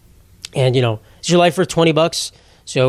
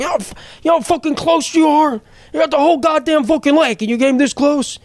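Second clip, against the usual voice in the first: mock furious captain yelling, much higher and strained; imitating angry captain; 11 semitones higher than usual. Fishing captain cursing out another boat, imitated in a high furious yell. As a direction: blast the other boat like a raging captain. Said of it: "He's like".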